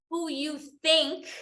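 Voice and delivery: who you THINK, a gentle lift on think; stress on think. Shown as a demonstration. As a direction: think leaned on, pitched a little up. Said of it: "I emphasize that word think".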